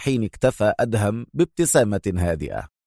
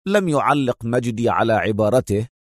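usual speaking voice

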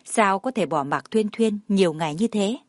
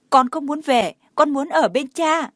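put-on high, louder little-boy refusal; acted childlike protest; protesting child; 6 semitones higher, 5 dB louder than usual. A narrator's reading. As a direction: insist like a small boy refusing to go. Child refuses to leave his father.

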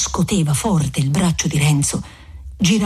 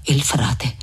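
ordinary delivery, nothing marked

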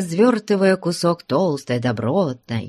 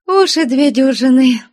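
put-on smiling old woman; 9 semitones higher, 6 dB louder than usual. A narrator's reading. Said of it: "улыбаясь".

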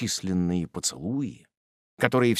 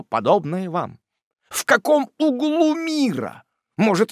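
much higher, louder gushing praise; rapturous; 6 semitones higher, 4 dB louder than usual. A narrator's reading. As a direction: gush with delight. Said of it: "восторженный Топман".

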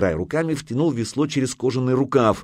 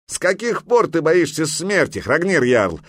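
high, raised-voice challenge; shouting; 8 semitones higher, 4 dB louder than usual. A narrator's reading. Грейпюр challenges the jarl's courage, shouting. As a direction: shout it as a taunting demand. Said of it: "закричал Грейпюр".

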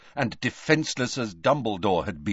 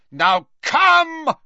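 high, loud shouted command; Snape; 7 dB louder than usual. A narrator's reading. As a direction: summon a henchman across the field. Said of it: "he shouted".

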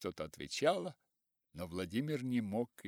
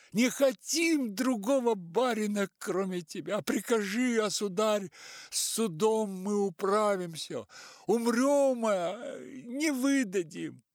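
high, loud, pleading peasant voices; crowd of peasants; 10 semitones higher, 6 dB louder than usual. A narrator's reading. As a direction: beg the master in unison.

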